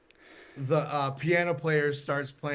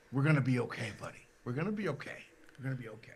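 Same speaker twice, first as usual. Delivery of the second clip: soft, fading to a hush; calm a nervous newcomer, quieter with each repeat; acted-out soothing murmur; gentle reassurance; 5 dB quieter, much faster than usual. Star reassures nervous newcomer backstage.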